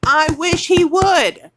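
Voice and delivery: slow loud furious dare; defiant challenge. Real, not acted. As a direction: call him out, daring him. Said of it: "I'm furious".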